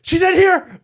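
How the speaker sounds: high, loud call to the door. Retold or imitated. Husband calling the arriving midwife, loud and high. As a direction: shout so she hears you from the hall.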